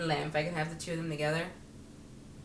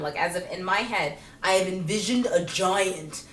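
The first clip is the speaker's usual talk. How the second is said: ordinary talk, nothing marked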